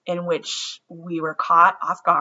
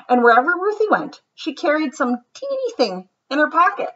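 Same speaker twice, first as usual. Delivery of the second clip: lively, sing-song storybook read-aloud; reading to children; 5 semitones higher than usual. A narrator's reading. Teacher reads a picture book to her class, lively and sing-song. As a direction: read it like story time for kids.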